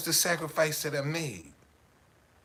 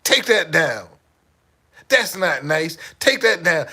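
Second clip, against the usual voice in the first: slow, loud disapproving wife; a touch of a prim wife scolding; 3 semitones higher, 11 dB louder, slower than usual. Retold or imitated. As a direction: scold him gently but firmly. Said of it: "She said".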